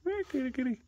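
high sing-song kitty call; high cat call. Real, not acted. Owner calling the cat in a high sing-song. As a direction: coax the cats in up high.

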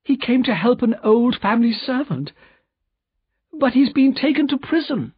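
very high-pitched, anxious plea; distressed cry. A narrator's reading. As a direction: pour out bad news in high distress. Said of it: "cried Lucy".

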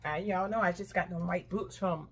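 usual speaking voice

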